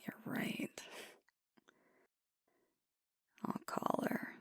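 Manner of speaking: soft close whisper, words barely voiced; intimate whisper. Alpha confessing self-doubt to his partner, in a whisper. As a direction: confide it in a hush, close to the ear.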